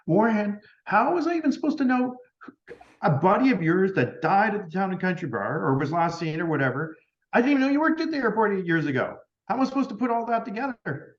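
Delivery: high-pitched, incredulous self-defence; acted-out exasperated argument; retold exasperation. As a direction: protest at how unreasonable this is, pitch jumping up. Retold argument, defending herself to Orion.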